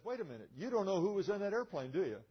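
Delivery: loud, high voice of a colonel catching on; retold dawning realization. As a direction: imitate someone suddenly working it out. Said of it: "it finally dawned on the guy".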